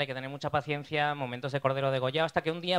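ordinary talk, nothing marked

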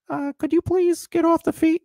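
high put-on voice of a bored casting reader; mock actor voice. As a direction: parody a stiff reader doing the line in a silly high voice. Acting out casting scene partner.

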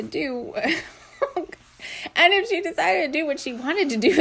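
giggling through the words, slow; can't keep a straight face reading; laughing while reading aloud. Real, not acted. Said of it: "It's making me laugh".

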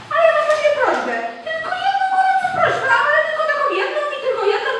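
a wife rushing a sweet request to her husband, fast and high; gushing rush. Acted. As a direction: rattle off the request breathlessly.

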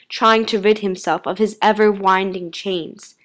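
ordinary delivery, nothing marked

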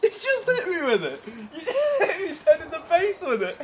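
words broken by laughter; laughing. Real, not acted. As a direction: laugh through it. Laughing after joke.

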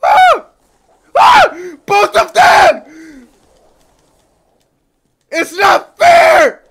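loud, high screams of frustration; frustrated gamer screaming; real screaming. Real, not acted. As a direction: scream it out, outraged and slowed. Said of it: "I'm just in here fucking screaming".

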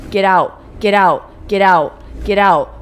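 loud repeated command to the lady; firm shout. Pretend. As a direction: shove her out with your voice. Shoving NPC out.

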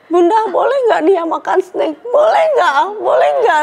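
high, drawn-out mock whine; tearful child pleading. Daughter asks Bunda for a snack, mock-crying and high. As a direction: imitate a small child begging through tears. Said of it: "nangis, ntar kayak".

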